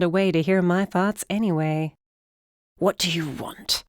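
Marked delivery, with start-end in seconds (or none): 2.8-3.8 a hint of a sharp old man. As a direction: ask it curtly as an old man. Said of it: "Wesley asked sharply".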